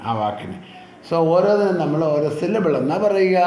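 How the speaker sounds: ordinary talk, nothing marked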